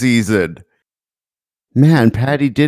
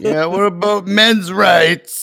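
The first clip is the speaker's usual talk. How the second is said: high, drawn-out mock complaint; slow pretend indignation; mock activist; 6 semitones higher than usual. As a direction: stretch it out like a joke protester. Joking mimicry of men's rights activists.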